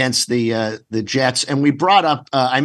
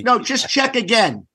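high, insistent pleading, a bit higher; pleading at the door; 7 semitones higher than usual. Retold or imitated. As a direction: beg the bouncer to check again. Hopeful fans at club door.

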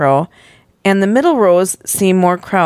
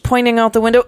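plain reading voice

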